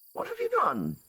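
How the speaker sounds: the villain sees her plan collapse; a lightly voiced shocked villain; crying out. A narrator's reading. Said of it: "Victoria cries".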